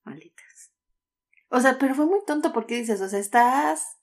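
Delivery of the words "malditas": whispered curse. Real, not acted. Cursing the mines under breath.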